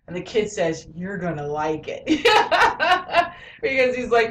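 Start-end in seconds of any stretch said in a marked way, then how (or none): 0.9-2.0 low, soft mock-sly delivery; knowing reply. Imitates kid's knowing reply. 2.1-3.3 high burst of laughter; real amused laugh. Real, not acted. Laughs at quoted line.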